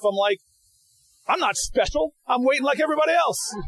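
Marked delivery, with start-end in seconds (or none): 1.3-3.5 high-pitched, protesting retold yell; mock yelling. Senator stuck in a voting line to his staff, mock yelling. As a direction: act out yelling down the phone, more pitch than volume. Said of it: "I just yell at my staff".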